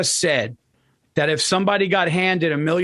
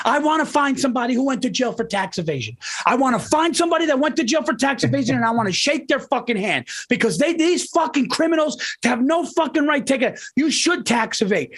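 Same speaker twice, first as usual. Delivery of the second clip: much higher, fast heated rant; imitating a relative losing it over taxes; ranting quote; 8 semitones higher than usual. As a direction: spit it out like a furious uncle. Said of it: "This is what he said".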